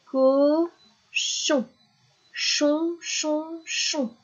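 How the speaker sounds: high, split "co, chon", then "chon" three times; syllable drill. Shown as a demonstration. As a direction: drill "chon" as the end of "Cochon". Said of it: "répéter la dernière syllabe".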